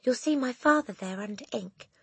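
put-on high child's voice; reply in a high, boyish voice; Eric. A narrator's reading. Boy standing up to the headmistress, high voice.

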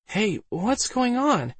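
high, drawn-out confused female voice; bewildered Alice. A narrator's reading. Newly woken Alice to Waldo who pulls her up, bewildered. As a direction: ask it high and slow, puzzled.

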